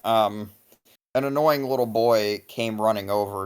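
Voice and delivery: ordinary delivery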